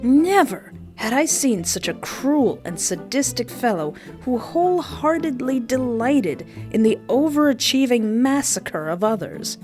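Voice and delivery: usual speaking voice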